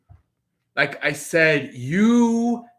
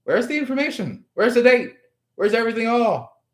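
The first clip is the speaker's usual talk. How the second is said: demanding questions; 6 semitones higher, faster than usual. Retold or imitated. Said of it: "You're like".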